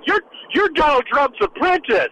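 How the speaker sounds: drawn-out, high mocking impression of an interrupting candidate; Tim Kaine. Panelist imitating a candidate cutting in with his zinger, mocking impression. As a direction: butt in with a slow, high-pitched canned zinger. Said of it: "that's my Tim Kaine impression".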